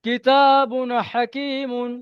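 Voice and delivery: slow, clear recitation. Shown as a demonstration. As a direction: recite it slowly and evenly. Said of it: "দেখেন".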